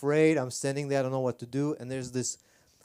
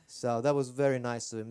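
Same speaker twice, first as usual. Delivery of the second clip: ordinary talk, nothing marked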